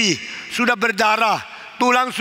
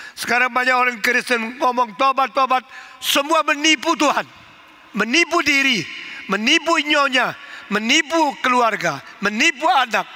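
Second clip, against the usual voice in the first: preaching rebuke; 4 semitones higher than usual. Acted. Preacher rebukes false repentance.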